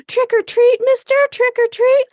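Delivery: put-on high, loud little kids chanting; squeaky kid voice. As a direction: pipe it up like eager children. Kids trick-or-treating voiced.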